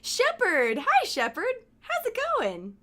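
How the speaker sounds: high, bright call to a friend; excited greeting. Real, not acted. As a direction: call out happily as a friend arrives. Greets an arriving acquaintance by name.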